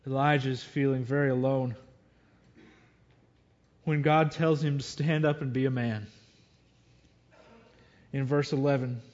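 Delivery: ordinary talk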